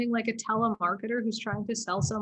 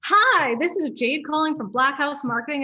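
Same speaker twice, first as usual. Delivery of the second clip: much higher and louder, sing-song sales greeting; peppy phone voice; 4 semitones higher, 5 dB louder than usual. Retold or imitated. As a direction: chirp the opener like a rookie caller. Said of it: "inflection in your voice".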